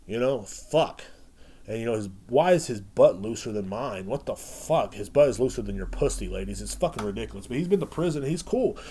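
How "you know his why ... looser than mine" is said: ordinary talk, nothing marked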